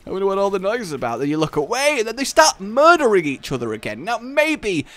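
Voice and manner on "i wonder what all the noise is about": lightly voiced clueless adult, quick; a hint of a puzzled parent; oblivious parent. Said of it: "you're like".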